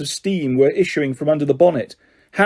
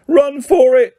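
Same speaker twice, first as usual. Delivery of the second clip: high, loud urgent shout; 9 semitones higher, 5 dB louder, slower than usual. A narrator's reading. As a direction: shout to flee now. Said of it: "Ron shouted".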